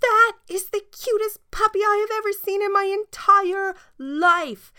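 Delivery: put-on little girl spotting the cutest puppy, high excited shriek; excited scream. A narrator's reading. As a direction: squeal like a thrilled little girl. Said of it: "she screamed".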